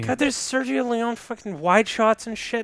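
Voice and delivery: mock exasperation. Bored fan watching a western standoff, mock exasperation. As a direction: plead in mock frustration.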